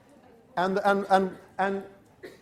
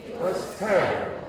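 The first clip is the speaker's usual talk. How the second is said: sounding upset. Shown as a demonstration.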